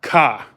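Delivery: "car" with no R; New England "car", R dropped; Northern pronunciation. Retold or imitated. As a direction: say "car" as an open "cah". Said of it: "We don't say".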